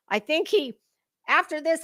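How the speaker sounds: ordinary delivery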